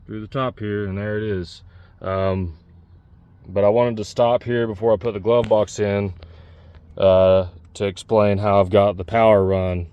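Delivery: ordinary delivery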